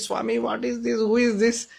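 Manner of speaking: lightly voiced startled driver asking the swami; frightened driver. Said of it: "he got so frightened".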